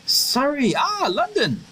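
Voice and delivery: high-pitched burst of recognition; light up as the place dawns on you, pitch soaring; delighted realisation. Acted. Lost visitor learning where he is, excited realisation.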